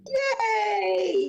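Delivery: high, drawn-out squeal of delight; excited cheer. Real, not acted. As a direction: celebrate the catch with a high, long cheer. Cheers at catching the Pokémon.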